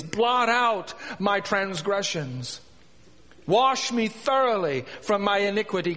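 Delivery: fervent psalm recitation. Acted. David before God pleading for mercy, fervent. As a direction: proclaim each petition with full preaching force.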